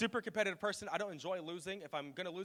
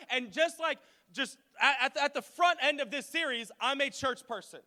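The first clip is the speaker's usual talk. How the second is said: high, deliberate sermon delivery; fervent, measured proclamation; impassioned preaching; 8 semitones higher, 4 dB louder, slower than usual. Real, not acted. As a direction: drive each point home to the congregation. Preaches on building the church.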